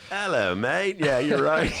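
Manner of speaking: high, put-on British-or-Aussie accent; catch-all British-Australian accent. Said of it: "this is his catch-all accent for anyone".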